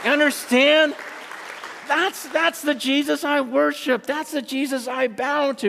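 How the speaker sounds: building to high-pitched fervor; impassioned preaching. Real, not acted. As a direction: exult in awe before the congregation. Preaching at full force.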